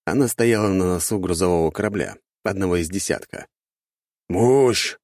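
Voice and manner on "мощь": one short, raised word; brief reply. A narrator's reading. Burly father answering his daughter about the blast, brief reply. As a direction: answer curtly, with a little force. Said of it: "кратко ответил крепкий мужчина".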